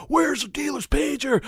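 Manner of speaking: high strained scream, held back in volume; mock scream. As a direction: quote the scream high but smaller. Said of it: "it's not so much the screaming part".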